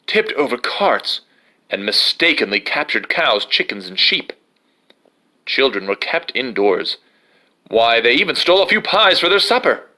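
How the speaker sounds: usual speaking voice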